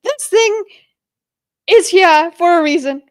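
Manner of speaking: loud, high-pitched retold realization; emphatic self-quote. Retelling a stubbed toe on the bicycle, loud emphatic self-quote. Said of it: "and I was like".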